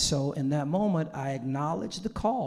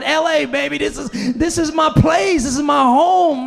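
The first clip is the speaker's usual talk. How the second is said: higher, loud hyped-up LA shout; 9 semitones higher, 10 dB louder than usual. Retold or imitated. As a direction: shout it like an LA anthem. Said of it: "I'm like [name]".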